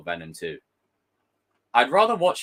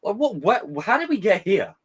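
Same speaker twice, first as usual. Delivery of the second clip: exasperated; 9 semitones higher, 4 dB louder than usual. Retold or imitated. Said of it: "I'm like".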